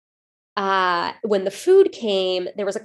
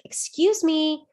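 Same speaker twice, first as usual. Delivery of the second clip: lightly acted customer calling for service; polite request, retold; 5 semitones higher than usual. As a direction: imitate a diner politely flagging down a server. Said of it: "stood up and just said".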